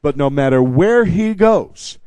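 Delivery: ordinary talk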